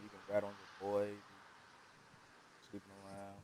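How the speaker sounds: faint, distant voice off the phone; talking away from the mic; phone held away. Real, not acted. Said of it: "We can barely hear you".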